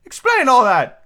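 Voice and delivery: high, loud plaintive turtle; a high wailing creature voice; Mock Turtle voice.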